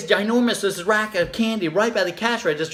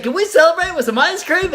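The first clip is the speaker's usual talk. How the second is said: put-on high, loud bouncy child; cheerful triumph; 11 semitones higher, 6 dB louder than usual. Little girl telling her parents the tantrum is over, gleeful. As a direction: announce it with bright glee. Child asking for ice cream.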